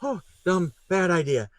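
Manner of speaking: dismayed groan. Retold or imitated. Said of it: "you're like".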